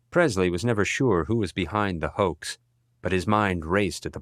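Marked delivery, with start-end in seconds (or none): none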